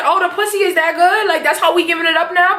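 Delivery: high, sassy jab; mock Tasha. Tasha mocking Tommy about his new girl, high sass. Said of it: "even Tasha said it".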